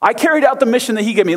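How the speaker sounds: high, fast defensive whine; whiny Saul. Acted. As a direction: insist like a kid covering up. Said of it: "whiny voice".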